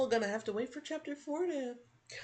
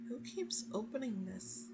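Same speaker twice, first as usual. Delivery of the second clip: normal talking voice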